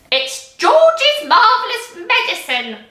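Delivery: put-on high, slow triumphant old-woman shout; Grandma shouting. A narrator's reading. Grandma shouting proudly to George's mother, high and slow. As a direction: crow it proudly from the rooftop. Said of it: "Grandma shouted".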